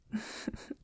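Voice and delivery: quiet, brief breath of a laugh; soft chuckle. Acted. Vampire teasing their love, soft chuckle. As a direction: chuckle softly under your breath.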